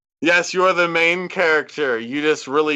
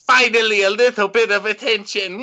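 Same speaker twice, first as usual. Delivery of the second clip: mocking villain voice; 6 semitones higher than usual. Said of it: "He's like".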